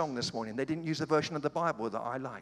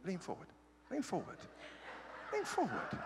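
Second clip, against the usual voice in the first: soft coaxing; 4 semitones higher, 9 dB quieter than usual. Shown as a demonstration. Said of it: "This is between me".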